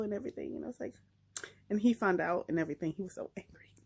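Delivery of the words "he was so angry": soft unvoiced whisper; telling viewers about the angry villain, whispering. Real, not acted. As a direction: drop to a whisper for the scary part. Retelling a scary scene.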